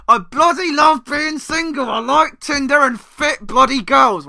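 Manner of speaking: high, loud, stretched-out fake enthusiasm; mock insistence it's fine. Dumped ex trying to portray that it's fine, loud hammed-up bragging. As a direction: overdo the boasting in a put-on voice. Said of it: "So tries to portray that it's fine".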